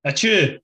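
a big manly sneeze; sneezing. Real, not acted. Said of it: "Big manly sneeze there".

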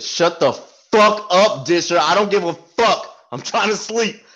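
loud, slow yell at a chatty bunkmate; retold yell. Yelling from his cot at Disher to shut up. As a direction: bark it from the cot, annoyed. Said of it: "Loud as shit".

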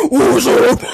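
loud gruff rapper greeting; tough rapper voice. Retold or imitated. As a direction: shout it like a tough guy.

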